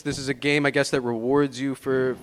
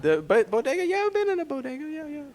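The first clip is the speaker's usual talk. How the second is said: light voice; 13 semitones higher than usual. Real, not acted. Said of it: "when a man get light voiced".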